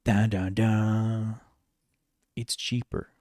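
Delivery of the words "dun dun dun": sung-out dun-dun-dun, last note held; dramatic sting. Pretend. Gamer filling in for a missing soundboard, dramatic sting. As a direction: mimic a soundboard's suspense sting. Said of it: "I need a soundboard".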